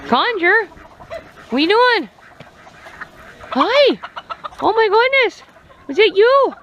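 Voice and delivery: high, excited cooing to a pet; high-pitched, surprised delight; delighted pet talk. Real, not acted. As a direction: gush at a clever little bird. Cooing at young crowing rooster.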